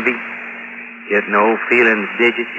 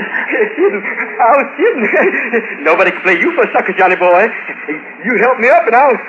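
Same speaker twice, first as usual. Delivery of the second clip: put-on unhinged drunk; 10 semitones higher than usual. Joey backing down, crazed.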